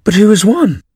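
high, eager crowd of birds; breathless racers clamouring; panting birds asking. A narrator's reading. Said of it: "panting and asking".

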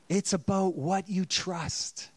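ordinary talk, nothing marked

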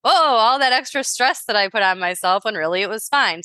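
ordinary talk

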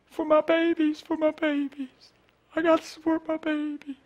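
high, pleading woman's voice; high pleading imitation of the woman. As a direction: beg him to understand.

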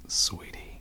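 unvoiced whisper. Real, not acted. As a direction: breathe the word softly. Whispered endearment.

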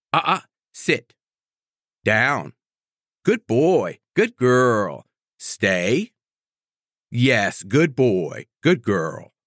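brisk commands then praise; dog trainer commands. Shown as a demonstration. Trainer demonstrating correct and redirect to a puppy, brisk then praising. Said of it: "So it would be like this".

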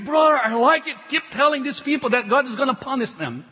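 ordinary talk

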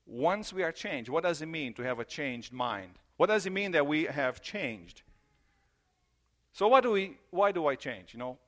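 ordinary talk, nothing marked

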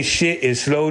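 ordinary delivery

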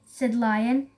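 ordinary delivery

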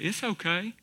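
a hint of Doctor Phil, soft and soothing; lightly voiced TV psychologist, soft and reassuring; Doctor Phil impression. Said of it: "Can you hear Doctor Phil".

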